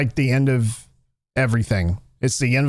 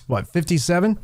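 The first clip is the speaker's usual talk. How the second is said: ordinary delivery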